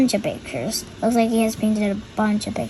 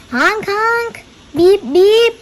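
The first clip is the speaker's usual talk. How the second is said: car horn; 8 semitones higher, 6 dB louder than usual. A narrator's reading. Car horn sound in story.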